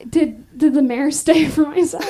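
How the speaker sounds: ordinary delivery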